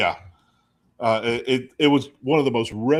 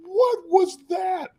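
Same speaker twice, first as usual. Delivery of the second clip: very high, slow stunned question; stunned disbelief; much slower than usual. Retold or imitated. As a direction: gasp it out, baffled. Laughing disbelief.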